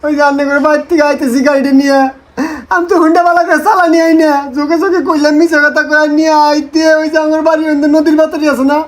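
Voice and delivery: mock-tearful tale of woe; put-on crying between phrases; fake sobbing. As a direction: whimper the story as if hurt. Tearful sham account of crash.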